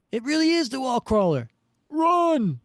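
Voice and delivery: high, loud panicked thug; panicked shout. Acted. Thug shouting to his pals to run, high panicked yell. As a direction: yell it and bolt in terror.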